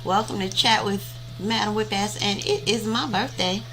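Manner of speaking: ordinary talk, nothing marked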